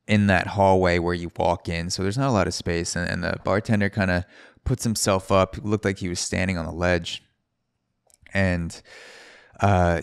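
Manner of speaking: usual speaking voice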